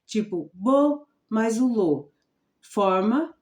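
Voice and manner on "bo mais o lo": "bo" and "lo" as separate slow syllables; slow teacherly sounding-out of "bo" and "lo". Shown as a demonstration. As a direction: sound out "bo", pause, then "lo". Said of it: "se você pegar uma sílaba, juntar com outra".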